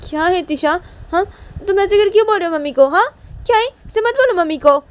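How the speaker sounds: put-on high, fast, loud little-girl voice; little sister scolding. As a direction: squeak it out like a cheeky younger sister. Little sister Shanaya scolds Tisha.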